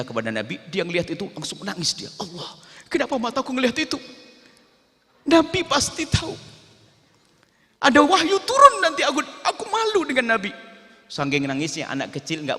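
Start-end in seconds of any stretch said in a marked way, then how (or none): 2.2-10.5 put-on high, loud crying boy's lament; broken-hearted child sobbing his shame; tearful wail. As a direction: wail it out like a guilty boy in tears. Boy weeps, ashamed before Prophet.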